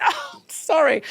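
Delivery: very high, squeaky sorry; mortified apology. Retold or imitated. Realising who [name] is, very high mortified sorry. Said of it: "I went".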